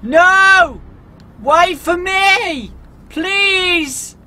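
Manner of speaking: high, loud, slow cries after the car; desperate plea. Acted. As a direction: beg it not to leave, voice nearly crying. Car drives past him.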